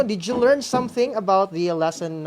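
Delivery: ordinary delivery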